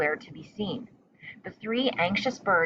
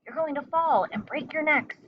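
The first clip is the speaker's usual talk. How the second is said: high, urgent scolding; scolding mother; 9 semitones higher than usual. A narrator's reading. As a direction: snap at the babies to get back. Mother bird scolding babies.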